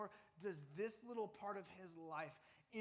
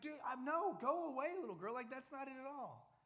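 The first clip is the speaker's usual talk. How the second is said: higher, louder panicked brush-off; scared denial; 9 semitones higher, 6 dB louder than usual. Acted. Frightened Peter tells the little girl to go away, higher and louder. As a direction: shoo her away in a panic. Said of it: "This guy was scared".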